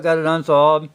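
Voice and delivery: ordinary delivery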